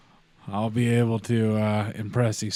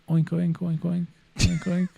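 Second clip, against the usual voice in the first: high oinking squeaks; guinea pig voice; 8 semitones higher than usual. Pretend. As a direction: oink like a little pig. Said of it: "in his other ear, I go".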